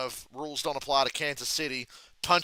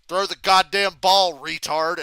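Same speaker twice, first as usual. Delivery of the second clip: loud, raised yell at the game; re-enacted shout, higher and much louder; retold scream; 5 semitones higher, 10 dB louder than usual. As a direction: holler it like a heckling fan in a bar. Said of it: "I just screamed".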